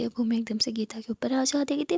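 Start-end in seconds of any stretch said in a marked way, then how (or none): none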